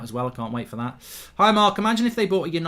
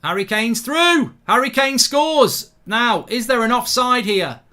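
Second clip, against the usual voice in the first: higher, louder, drawn-out slow goal shout; excited goal call; 9 semitones higher, 9 dB louder, slower than usual. Real, not acted. Streamer calling Harry Kane's goal to viewers, excited slow shout. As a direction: call it like a commentator as Kane scores.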